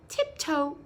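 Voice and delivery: plain reading voice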